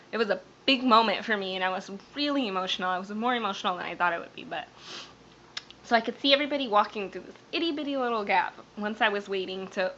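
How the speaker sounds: ordinary talk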